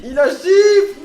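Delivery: very high, drawn-out scream; panicked scream. Real, not acted. Killer shifts again, screaming.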